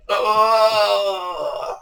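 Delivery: a high, soft imitated cry of pain; imitating the angler's cry as he falls off his box; mock yell. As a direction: mimic the bloke's startled "Oh!". The bloke cries out, falling.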